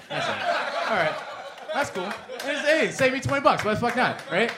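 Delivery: high, laughing, overly cheerful; laughing mock cheer. The gym guy skips his tanning fee, high laughing cheer. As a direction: laugh it off with a high forced brightness. Retold fake-cheerful acceptance.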